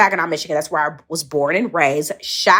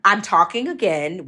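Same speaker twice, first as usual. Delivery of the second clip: ordinary delivery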